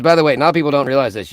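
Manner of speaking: ordinary talk, nothing marked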